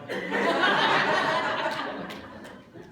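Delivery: airy high laugh; breathy high laughter. Real, not acted. Laughs at own joke.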